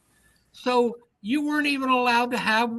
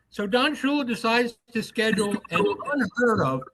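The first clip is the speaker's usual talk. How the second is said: normal talking voice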